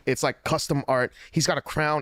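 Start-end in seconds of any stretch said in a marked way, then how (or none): none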